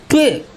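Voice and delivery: high squeak of annoyance at a misread; a quick high bleh at her own slip; frustrated bleh. Real, not acted. Reader squeaks at her own fumble.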